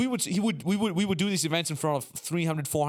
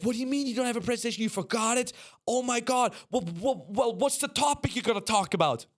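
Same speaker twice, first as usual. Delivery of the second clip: fast, higher, frantic; panicked crew; 7 semitones higher than usual. Retold or imitated. Stage crew panicking at a speaker with no slides, fast and high. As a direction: rattle it off like stagehands losing it. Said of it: "And they would freak out".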